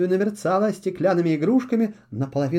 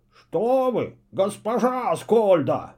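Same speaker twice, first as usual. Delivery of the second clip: feigned horror; 3 semitones higher, slower than usual. A narrator's reading. Owl caught napping fakes horror to his mistress. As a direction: pretend to be shocked at the accusation. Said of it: "в притворном ужасе воскликнул Филин".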